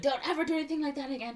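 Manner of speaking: high, fast scolding friend; mock scolding. Friend warning Ariel at the diner, high fast mock scold. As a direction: rattle off a fussy warning.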